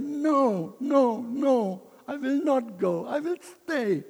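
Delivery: put-on high, loud childish protest; temper tantrum. Missionary protesting to God about leaving India. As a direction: stamp your feet and refuse, like a tantrum. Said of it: "I had a temper tantrum with God".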